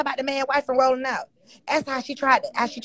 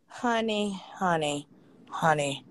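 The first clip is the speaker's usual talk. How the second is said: low, soft, fading honey; resigned honey; 8 semitones lower, 9 dB quieter, much slower than usual. Real, not acted. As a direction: drop into a weary sigh. Sighing exasperation.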